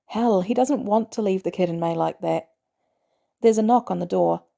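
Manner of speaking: usual speaking voice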